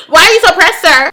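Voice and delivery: amused mock question; laughing tease. Real, not acted. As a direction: tease him while laughing. Mocking the jealous guy.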